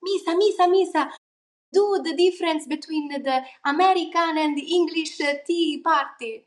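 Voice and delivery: a mother calling her daughter to perform; mom calling. Retold or imitated. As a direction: call her name like an insistent mom. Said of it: "my mom would be like".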